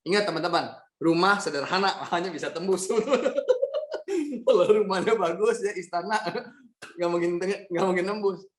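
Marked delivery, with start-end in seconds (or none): 2.9-4.4 a sudden high laugh that falls away; high burst of laughter. Real, not acted. Narrator laughing at the house that was too flimsy. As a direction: laugh out at your own joke. Laughing at his own story.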